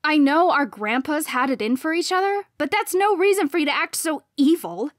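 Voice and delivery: high, loud, angry reproach; snarled. A narrator's reading. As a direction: snarl it at your rival. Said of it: "I snarled".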